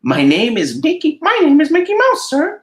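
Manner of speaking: squeaky friendly cartoon mouse; Mickey Mouse falsetto. Mickey voiced high.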